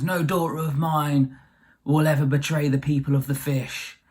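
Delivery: lightly voiced raging dad, measured declaration; a hint of an outraged dad laying down the law; mock outraged father. Dad forbids his daughter betraying the fish people, mock outrage. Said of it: "her dad finds out about this and goes absolutely crazy".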